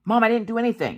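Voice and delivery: put-on high, protesting kid voice; imitating the daughter. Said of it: "Maggie, what did you do?".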